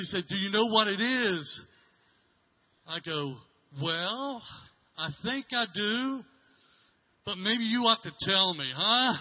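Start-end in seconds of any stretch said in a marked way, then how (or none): 0.3-1.6 higher, louder excited question; eager little girl. Retold or imitated. Excited daughter quizzes her father about her drawing, higher and louder. 3.8-9.1 higher, louder, slow coy tease; playful teasing. Retold or imitated. Father teasingly coaxes daughter to explain her drawing, higher and louder.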